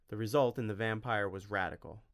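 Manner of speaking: plain reading voice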